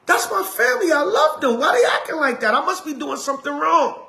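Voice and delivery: high, pleading voice of a shaken believer; doubting believer. Retold or imitated. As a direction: whine it up high, hurt and unsure. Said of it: "you might be like".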